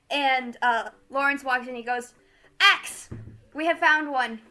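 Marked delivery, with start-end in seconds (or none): 2.6-4.4 triumphant announcement. Acted. Lawrence calling to Axe about the captured ginger, loud and proclaiming. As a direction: call out the find to your comrade.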